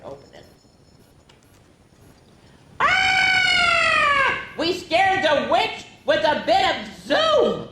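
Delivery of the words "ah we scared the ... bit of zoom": high loud shriek, then excited; hammed-up fright; witch scream. Witch scared by zoom.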